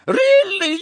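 very high, loud, flustered squeal; shrieky, scandalised lady's exclamation. A narrator's reading. Shop owner shocked by the accusation, squealing. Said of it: "Madame Malkin squealed".

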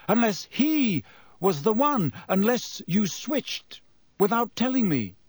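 stunned, rising disbelief; dawning realization. Acted. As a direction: piece it together aloud. Lupin's sudden realization.

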